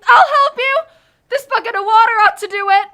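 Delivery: loud, high, urgent cry; urgent shout. Acted. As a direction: shout as you rush in. Dorothy throws water.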